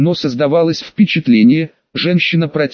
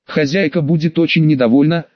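ordinary delivery

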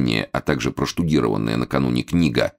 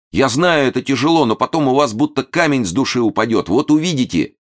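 high, earnest reassurance; fervent; 9 semitones higher, 3 dB louder than usual. A narrator's reading. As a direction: plead with eager youthful conviction. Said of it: "горячо воскликнул". (горячо убеждает высоким голосом)

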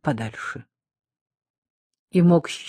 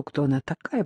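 ordinary delivery, nothing marked

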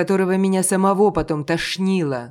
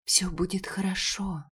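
whispering; 5 semitones higher, 6 dB quieter than usual. A narrator's reading. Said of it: "прошептала Элейн".

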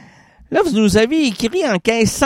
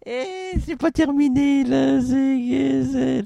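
ghost voice; 7 semitones higher, slower than usual. Acted. Spirit voice protests.

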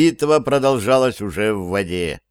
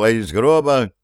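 ordinary delivery

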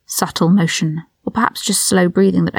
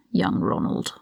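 breathy whisper; 8 dB quieter, slower than usual. A narrator's reading. Malfoy's sneering aside.

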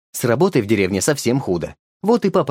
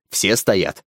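ordinary delivery, nothing marked

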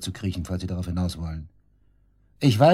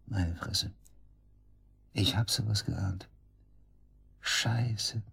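shocked mutter; 9 dB quieter, much slower than usual. Acted. Suspect realises she is dead.

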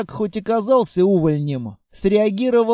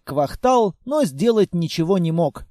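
usual speaking voice

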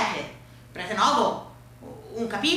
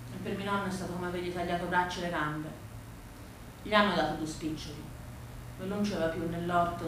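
low, soft, heavy telling; subdued sorrow; 5 semitones lower, 6 dB quieter than usual. A narrator's reading. Granddaughter tells of motorway built through grandfather's land, subdued. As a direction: let it sink low and quiet, grieving.

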